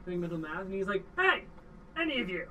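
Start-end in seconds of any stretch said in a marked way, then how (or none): 1.2-2.5 mildly raised call to the crowd. Acted. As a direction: call out to the room, a bit louder. GM voices NPC calling out.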